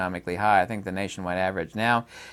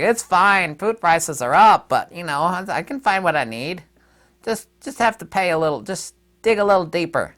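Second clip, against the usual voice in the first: higher-pitched, put-on voice of the oblivious; mock oblivious people; 7 semitones higher, 4 dB louder than usual. Host mimicking people unbothered by food prices, mock oblivious. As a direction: imitate people breezily brushing it all off. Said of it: "the oblivious people are like".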